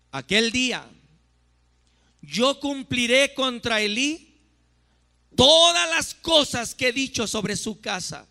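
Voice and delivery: loud, high declamation of prophecy; thundering scripture proclamation. Acted. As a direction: proclaim God's judgment at full force. (proclamación profética a toda voz)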